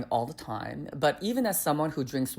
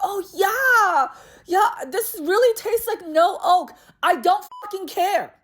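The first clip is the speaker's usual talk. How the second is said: much higher, louder, fed-up rant; exasperated outburst; 5 dB louder than usual. Retold or imitated. Fed up with the server, much higher louder rant. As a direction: blow up in frustration at the wine talk.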